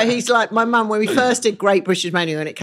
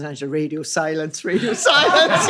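laughing quote. Retold or imitated. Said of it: "His wife goes".

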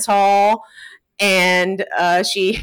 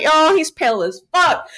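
ordinary talk, nothing marked

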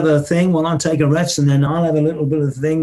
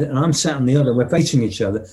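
ordinary talk

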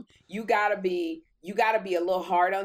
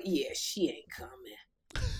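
soft, slow, knowing murmur; deadpan aside; 5 semitones lower, 7 dB quieter, slower than usual. Retold or imitated. Telling the trainer Kim won't show, soft and slow. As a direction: say it low like you already knew. Retold reply about Kim.

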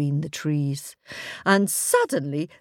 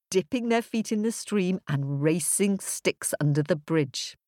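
ordinary delivery, nothing marked

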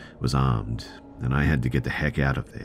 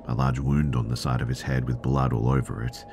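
ordinary delivery

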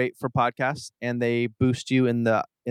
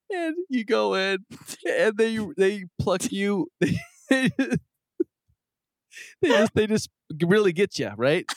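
high squealing laughter through words; can barely get it out for laughing; laughing hard; 7 semitones higher than usual. Real, not acted.

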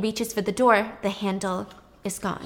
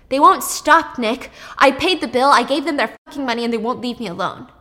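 angry outburst; 3 semitones higher, 7 dB louder than usual. Retold or imitated. Brother raging about harassment.

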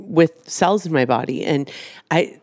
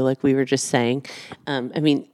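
usual speaking voice

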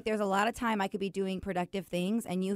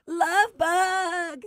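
high, drawn-out, much louder Love Bug; sweet pet name; 10 semitones higher, 8 dB louder than usual. Shown as a demonstration. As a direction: call him sweetly, stretching it out. Suggests a sweeter nickname.

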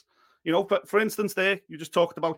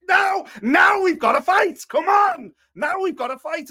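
loud, high, drawn-out yell; fired-up fighter's shout; 11 semitones higher, 7 dB louder than usual. Acted. Acting out a fighter throwing back the teddy, fired-up shout. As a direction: roar it as a challenge to a rival. Acts how Josh should have reacted.

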